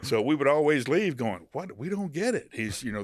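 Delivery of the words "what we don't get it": high-pitched puzzled disbelief; retold "we're stumped" reaction, voice lifted high; mock bafflement. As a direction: act out being totally baffled, pitched up. Retells friends' baffled reaction.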